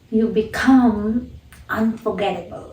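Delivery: quiet, "become" rising then "unforgettable" falling; stress on become. Shown as a demonstration. Teacher modelling how Oprah says "become". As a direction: lift "become", let "unforgettable" fall. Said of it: "Notice how she's pronouncing become".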